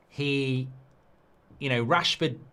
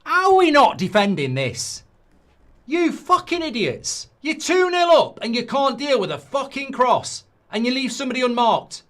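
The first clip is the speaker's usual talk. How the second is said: loud, much higher angry shouting; furious rant; 10 semitones higher, 5 dB louder than usual. Real, not acted. Fan raging at defenders over a conceded cross. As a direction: berate the defenders at full volume.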